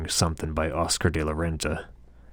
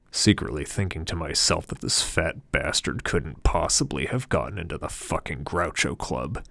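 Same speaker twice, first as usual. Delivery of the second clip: ordinary delivery, nothing marked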